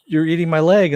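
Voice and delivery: loud, high, horrified yell; horrified shout. Retold or imitated. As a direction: shout it in disbelief at the cannibals. Character discovers his leg eaten.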